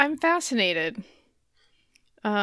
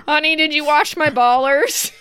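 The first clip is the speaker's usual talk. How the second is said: mock wife; 6 semitones higher, 8 dB louder than usual. Mock wife asking husband.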